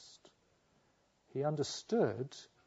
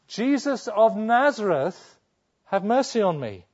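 shout; 8 semitones higher, 9 dB louder than usual. Retold or imitated. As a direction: call out across the crowd for help. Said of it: "expect Bartimaeus now to shout out".

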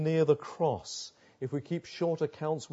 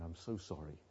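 soft apology; 9 semitones lower, 14 dB quieter than usual. Retold or imitated. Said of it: "ring Missus Vine up and say".

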